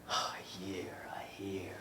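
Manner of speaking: hushed conspiratorial whisper. Acted. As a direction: drop to a secret whisper. Hushed turn in the poem.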